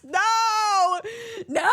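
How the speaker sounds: loud, high, laughing no; laughing protest. Real, not acted. Voice actress rejecting the surfer-voice joke, laughing shout.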